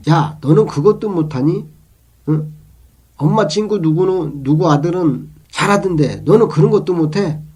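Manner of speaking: loud, high-pitched mock telling-off; imitated scolding. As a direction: act out a parent berating a child. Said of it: "라고 비교하며 야단을 친다면".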